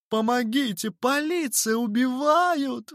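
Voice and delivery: very high cry, last word stretched; echoing wail. A narrator's reading. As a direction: wail for the police in alarm. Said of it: "вторила миссис Миллер". (истошно голосить)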